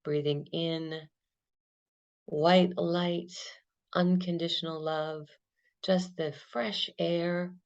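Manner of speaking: calm, unhurried meditation guide; guided meditation. Acted. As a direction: lead them gently through the breath. Guided body-scan meditation.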